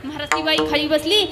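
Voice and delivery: ordinary delivery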